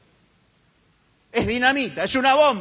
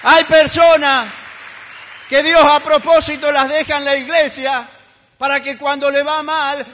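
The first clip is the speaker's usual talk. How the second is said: full-force preaching; 3 semitones higher, 7 dB louder than usual. Acted. Preacher thunders at the church, full force. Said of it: "preaching at full force".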